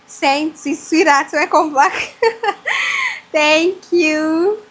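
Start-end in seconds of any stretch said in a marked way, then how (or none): 2.2-3.3 delighted shrieking laugh. Real, not acted. Greeting returning viewer Sainty.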